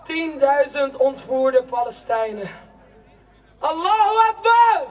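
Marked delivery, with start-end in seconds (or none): 3.6-4.8 high, loud cry of Allahu Akbar; a rally cry over the crowd; ecstatic cry. Acted. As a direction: throw Akbar out high and full. Chanting cry in protest poem.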